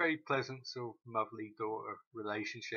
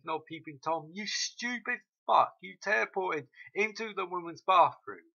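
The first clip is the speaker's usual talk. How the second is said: imitating Ando yelling at Hiro for teleporting; angry scolding quote; 4 semitones higher, 7 dB louder than usual. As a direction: snap at him like an angry friend.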